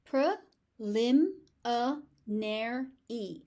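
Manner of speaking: „Pre-lim-a-nary“ slowly, every syllable separate. Shown as a demonstration. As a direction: spell out all five syllables. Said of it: "There are five syllables".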